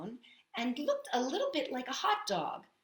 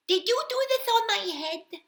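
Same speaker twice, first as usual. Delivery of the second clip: high squeaky question; 12 semitones higher, 6 dB louder than usual. Acted. Mole questions the pig.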